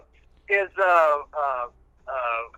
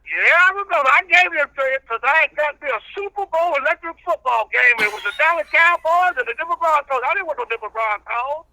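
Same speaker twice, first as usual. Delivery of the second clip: country preacher character; 7 semitones higher than usual. Acted. Old reverend preaching patience to the radio host, in full preacher voice.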